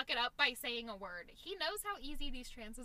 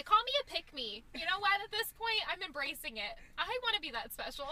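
gleeful; 6 semitones higher, 7 dB louder than usual. Real, not acted. Gleefully embracing being a pick-me.

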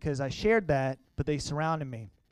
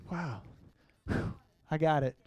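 softer, breathing out the emotion; real emotion, steadying the breath; pulling himself together; 4 dB quieter than usual. Real, not acted. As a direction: exhale and regain control. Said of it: "I'm getting a little emotional".

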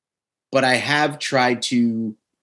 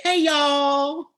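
high, drawn-out imitation Southern holler; mock tipsy Southern drawl; 13 semitones higher than usual. Host teases co-host about her drunk accent, mock Southern drawl. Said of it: "three beers in, why, like".

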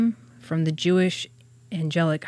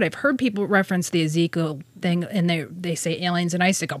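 normal talking voice

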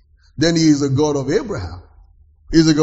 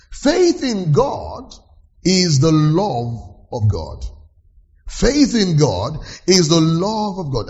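slow emphatic; slower than usual. Real, not acted. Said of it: "preacher slows key phrase".

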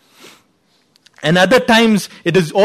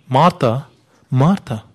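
gentle rebuke; 5 semitones lower, slower than usual. Retold or imitated. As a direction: call Martha, Martha as a tender correction. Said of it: "gracious and gentle rebuke".

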